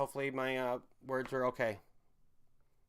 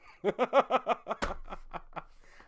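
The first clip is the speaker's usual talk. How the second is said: long, high staccato laugh; 8 semitones higher, 3 dB louder than usual. Real, not acted. Streamer laughing at his own pounds of love joke, high cackling laugh. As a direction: crack up in a rapid run of ha-has.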